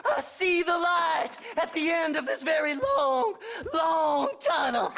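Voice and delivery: cackling, shouted triumph with laughter; gleeful whooping. Acted. Grave robber gloating over treasure, whooping with laughter. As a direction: whoop and laugh like you've struck it rich.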